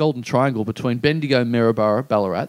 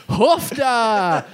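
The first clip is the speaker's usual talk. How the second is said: mocking cheer; 9 semitones higher than usual. Mate mocking him in the car.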